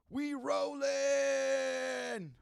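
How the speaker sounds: drawn-out hyped shout; hype shout. Acted. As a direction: yell it out like opening a show. Podcast opening hype call.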